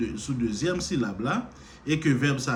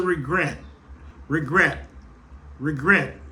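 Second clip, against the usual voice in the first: regret with GRET hit louder; stress on second syllable; 3 semitones higher, 4 dB louder, slower than usual. Shown as a demonstration. Teacher showing the rising syllable, regret stressed on GRET. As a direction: lift the voice on the last syllable of regret. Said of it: "On monte sous deuxième syllabe".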